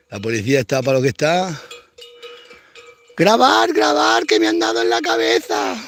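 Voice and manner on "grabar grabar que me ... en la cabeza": hammed-up cry to film it; mock complaining. Mimicking protesters calling to film their injuries, mock shout. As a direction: whine like a victim calling for cameras. Mocking protesters filming police.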